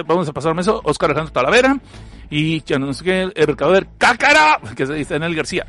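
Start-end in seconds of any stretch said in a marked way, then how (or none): none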